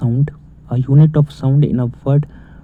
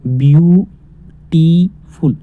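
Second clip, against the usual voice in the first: Beautiful very slowly split into three parts, each stretched; slow teacher's breakdown of beautiful; broken into syllables; 3 semitones higher, 3 dB louder, much slower than usual. Shown as a demonstration. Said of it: "तीन type में तोड़ के पढ़ा हमने".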